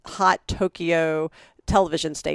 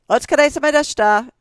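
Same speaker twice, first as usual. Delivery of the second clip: louder, higher, quick office farewell; calling out; 9 semitones higher, 6 dB louder, faster than usual. Retold or imitated. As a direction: call it out briskly to the whole office. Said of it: "kinda yells back".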